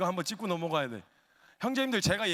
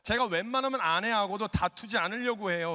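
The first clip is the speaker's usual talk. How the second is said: ordinary talk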